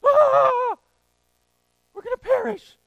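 very high, loud melodramatic shriek; hammed-up panic. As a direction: play an overwhelmed mother losing it. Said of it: "what all the mothers feel right now".

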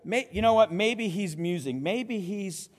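scoffing. Acted. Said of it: "his scoffing is really strong".

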